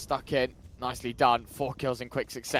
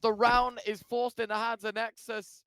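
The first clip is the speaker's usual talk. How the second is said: excited caster; 9 semitones higher than usual. Real, not acted. Caster still hyped after the clutch.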